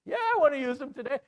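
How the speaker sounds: high, loud, fast retort; hammed-up royal anger, rushed; angry king. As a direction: fire back as an enraged king. Angry king answers the suggestion.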